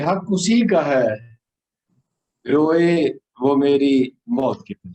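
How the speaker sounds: measured, solemn ghazal delivery; poetry recitation. Acted.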